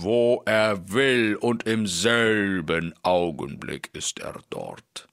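slow, heavy giant speaking with a strange timbre; two quarrelling giants, slow and weighty. Acted. Giant explains the wishing hat. (langsame, schwere Riesenstimme)

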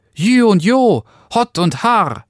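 very high, loud carter's cries; tiny voice yelling to a horse; Daumes Dick shouting commands. A narrator's reading. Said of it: "Und dann rief der Kleine".

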